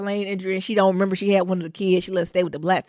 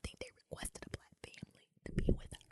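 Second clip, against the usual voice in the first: very soft, confidential whisper; real whisper, barely audible; 11 semitones lower, 11 dB quieter, faster than usual. Real, not acted. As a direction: whisper it like a family secret. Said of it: "Why you whispering?".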